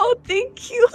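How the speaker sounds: tearful thanks. Acted. Lizard still crying, takes handkerchief.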